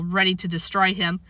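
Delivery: ordinary delivery, nothing marked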